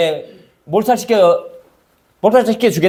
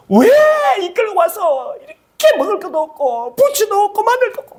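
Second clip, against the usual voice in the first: high, whiny mock protest; complaining crowd; 12 semitones higher than usual. People grumbling at Moses, high whiny protest. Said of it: "막 완전 막 난리를 피우는 거예요".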